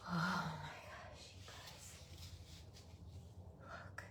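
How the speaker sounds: hushed whisper of wonder; awed whisper. Real, not acted. Amazed unboxer whispers to the viewers, awed. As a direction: breathe it out, stunned.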